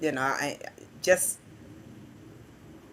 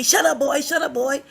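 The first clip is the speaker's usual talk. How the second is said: higher-pitched, lightly imitated parental scolding; a mild mock telling-off; scolding mother; 7 semitones higher than usual. Teacher quoting a pupil imitating his mother, scolding. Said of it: "She always tell me".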